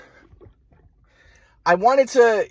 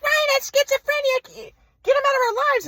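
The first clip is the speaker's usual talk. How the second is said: mock parents voice. Son mocking his parents' rejection, much higher voice. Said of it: "my mom and dad were like".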